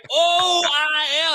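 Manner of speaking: "O I L" as slow, high, separate letters; high, drawn-out spelling of "O I L"; spelled out. Shown as a demonstration. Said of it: "that motherfucker didn't say".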